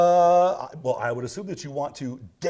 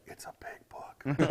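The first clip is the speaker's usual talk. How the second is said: mock secret whisper; 5 dB quieter, slower than usual. Don't tell anybody" secret aside.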